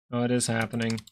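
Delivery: normal talking voice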